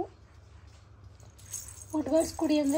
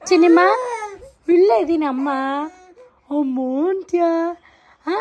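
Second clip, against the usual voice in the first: loud cooing; 4 semitones higher, 7 dB louder than usual. Real, not acted. Mother telling her wailing baby she is right here, loud cooing. Calls to crying baby over cries.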